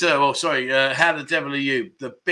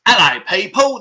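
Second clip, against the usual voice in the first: loud, higher London greeting; playful put-on London accent; 4 semitones higher, 6 dB louder than usual. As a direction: call it out in a mock London accent. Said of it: "Are you from London?".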